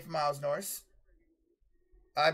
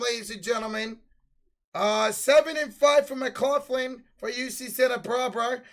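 lively broadcaster reading the numbers; announcer stat read; 7 semitones higher, 8 dB louder than usual. Acted. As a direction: rattle off stats like a halftime show. Reading halftime stats.

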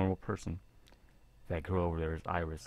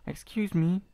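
put-on high, polite young woman calling out; 11 semitones higher, 5 dB louder, slower than usual. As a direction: voice the shy schoolgirl gently. Voicing girl character in visual novel.

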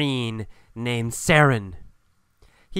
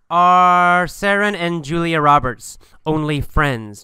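ordinary talk, nothing marked

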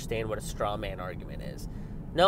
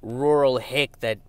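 normal talking voice

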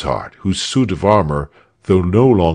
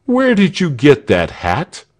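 a jeering shout from behind a rock; mocking call; 8 semitones higher, 4 dB louder than usual. A narrator's reading. Hidden heckler jeering at the guards, loud taunt. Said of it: "said the voice".

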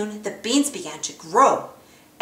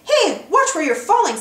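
higher, louder, scolding Goofy; cranky scolding; 8 semitones higher, 5 dB louder than usual. Acted. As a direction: snap at him in cartoon annoyance. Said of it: "scouted Donald".

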